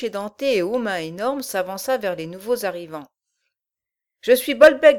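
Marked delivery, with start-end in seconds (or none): 4.2-5.0 loud, growling brute voice; gruff giant growl. Acted. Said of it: "grogna-t-il".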